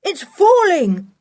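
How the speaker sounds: high, loud shout of warning; urgent high-pitched cry of danger; alarmed yell. A narrator's reading. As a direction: yell it, stretching the last word. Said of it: "yelled Julian".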